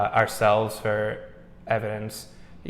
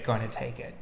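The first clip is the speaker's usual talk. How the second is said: soft, hushed mock whisper; imitated whisper; 8 dB quieter than usual. Investigator imitating a female voice mocking his friend, soft whisper. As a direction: drop it softer, hinting at a whisper. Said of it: "like, in a whisper".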